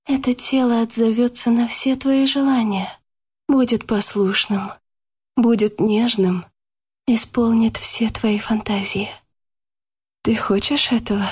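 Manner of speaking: seductive whisper. A narrator's reading. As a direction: lure him, half whispering. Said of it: "прошептала ему на ухо Яна".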